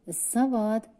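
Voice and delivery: high thin call from inside; thin little voice calling. A narrator's reading. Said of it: "kiáltotta egy vékonyka hang". (vékonyka hangon kiált)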